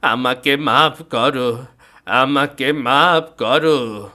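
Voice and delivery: slow, loud, stammering plea; terrified confession. Acted. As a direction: beg the ghost for mercy. Haridas confesses in fear.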